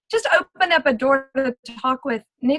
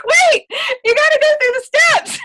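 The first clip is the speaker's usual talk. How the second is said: laughing, high and loud; laughing protest; 7 dB louder than usual. Retold or imitated. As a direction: protest through laughter at the speed. Laughing at how fast it went.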